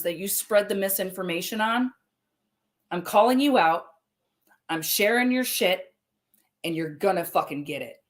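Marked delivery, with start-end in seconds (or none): none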